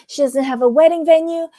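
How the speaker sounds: excuse-making. Retold or imitated. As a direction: imitate someone fussily making excuses for a friend. Acting out Marisol's excuses for Alexia.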